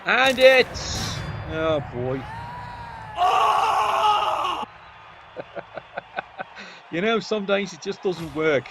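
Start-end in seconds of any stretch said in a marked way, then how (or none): 2.3-4.6 real shrieking laugh; high shrieking laugh. Real, not acted. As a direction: cackle in disbelief, pitched way up. Failed play, big laugh.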